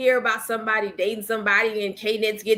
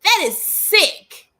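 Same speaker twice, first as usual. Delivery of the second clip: high, slow, drawn-out outrage; outraged disbelief; 9 semitones higher, 5 dB louder, much slower than usual. Real, not acted. Reacting to fans bullying an idol, high slow outrage. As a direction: stretch it out in shocked disgust.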